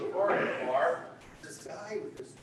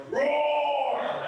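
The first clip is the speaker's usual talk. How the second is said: loud roaring bear; 4 semitones higher, 8 dB louder than usual. Acted. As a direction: roar like a bear. Bear growl in joke.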